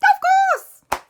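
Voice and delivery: shrieked very high and loud; high Nanny Plum voice. Acted. Nanny Plum agreeing to visit Mummy and Papa, squealing. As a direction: squeal it out with delight. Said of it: "Nanny Plum said".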